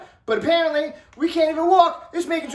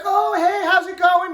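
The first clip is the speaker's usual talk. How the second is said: higher-pitched imitation of a nice mom saying hello; happy cheerful greeting; 5 semitones higher than usual. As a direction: greet them sweetly and cheerily. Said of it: "you know, happy, cheerful".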